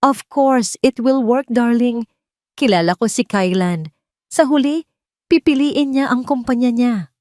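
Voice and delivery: the smug mistress exposed on a recording; Marikit's recorded voice. A narrator's reading. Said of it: "Ang tawa ni Marikit". (mayabang na boses ni Marikit sa recording, mas malakas)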